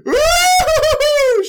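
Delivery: high, loud hammed-up squeal with a laugh; delighted squeal. Potiphar's wife seeing Joseph naked, hammed-up squeal. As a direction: squeal like she loves what she saw. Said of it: "And she's like".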